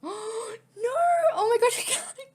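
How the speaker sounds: high, loud crying protest; real distraught shout; desperate tearful protest; tearful outcry. Real, not acted. Outburst at game episode ending.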